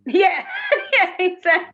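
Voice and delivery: laughing high and loud; giggling through the words. Real, not acted. Laughing at the pun.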